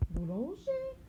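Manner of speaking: high, loud pretend child objecting; mock pupil protest. Teacher playing a pupil who says no, high and loud.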